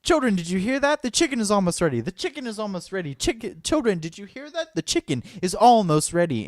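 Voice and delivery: high, frantic, hammed-up stage voice; panicked repeating actor. Imitating the actor stuck on one line, high frantic repeat. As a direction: squeak the line over and over in panic. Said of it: "begins to say it over and over".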